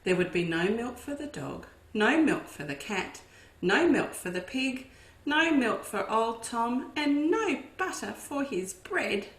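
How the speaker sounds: ordinary delivery, nothing marked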